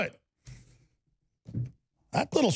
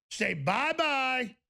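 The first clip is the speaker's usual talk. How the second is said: taunting bye-bye; 5 semitones higher, much slower than usual. Acted. Taunting the smashed box.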